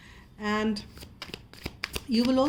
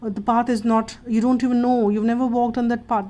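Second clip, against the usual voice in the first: louder, rising alarmed inner voice; the mind's chatter panicking about a new path, louder and alarmed; panicky head chatter; 5 dB louder, faster than usual. Retold or imitated. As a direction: voice the frantic mind chattering at you. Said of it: "it keeps saying".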